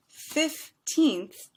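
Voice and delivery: put-on "fifteenth" slowly split into two beats, fif-teenth; two-syllable fifteenth. Shown as a demonstration. Teacher counting syllables for students, "fifteenth" in two slow beats. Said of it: "only has two units".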